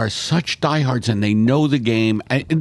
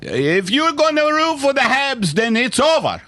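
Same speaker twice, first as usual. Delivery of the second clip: put-on French Canadian accent. French Canadian bit continued.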